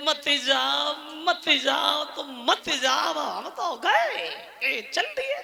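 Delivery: hushed mock-nonchalant parting; playful brush-off. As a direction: brush them off lightly under your breath, drawn out at the end. Shiva dismisses Parvati's warning in retelling.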